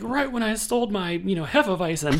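high, laughing mock whine; mock brewer lament. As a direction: play the betrayed beer nerd, half laughing. Mocking the brewer husband.

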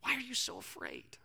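soft, whispered question; whispered plea. Retold or imitated. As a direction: ask it tenderly under the breath. Jesus gently pleads with disciples.

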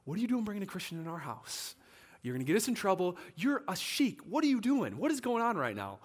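imitated freak-out, climbing in pitch. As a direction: act out an alarmed wife scolding her husband. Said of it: "she starts freaking out".

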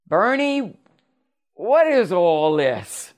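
high, drawn-out demand of a tipsy mother; a slow, stunned challenge; mother's shocked demand. Retold or imitated. Drunk mother confronting her son.

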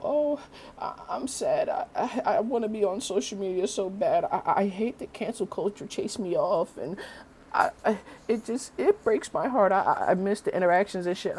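high, whiny, put-on crybaby voice; whiny mocking impression. Vlogger mocks a celebrity whining about social media, high whiny mock voice. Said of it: "bitching and crying about".

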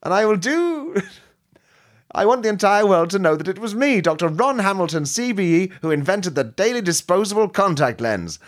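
high gloating supervillain reveal; villain voice. Acted. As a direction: unmask yourself with a grand sneer. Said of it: "I should have kept doing the voice, the other voice".

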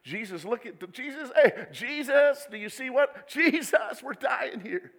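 high, loud, frantic calling; a half-laughing mock panic; panicked plea. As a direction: call out like you're sinking. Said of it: "you're like the disciples".

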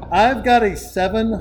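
ordinary talk, nothing marked

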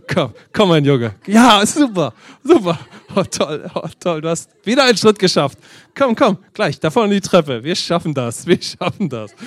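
hammed-up coaxing, step by step; mock parental cheering. Father jokingly coaxing grown son to his exam, mock cheering.

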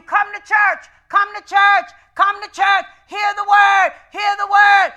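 loud, insistent appeal to the church; pleading preaching. Acted. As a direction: beg them to come, building up.

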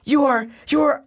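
high, furious mock rant; imitating mom yelling. Mom yelling at her son over speaker, high furious rant. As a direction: rail at him like an enraged mom.

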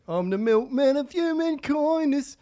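sing-song Bragg impression; mock [name]. Comic doing [name]'s English accent for co-host. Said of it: "[name] came out".

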